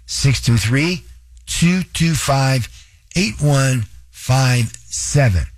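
very slow, digit groups set apart. Shown as a demonstration. Host repeating his phone number for listeners, slowly. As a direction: read the number out slowly, pausing between groups. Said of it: "I'll say it again slower".

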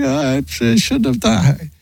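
imitated crying. Wife blaming herself over a fender bender, mock crying. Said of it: "she's crying about it".

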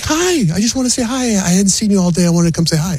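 high, sweet mock wife voice; lightly mocking impression of his wife; imitating wife. Wife voiced in retold story.

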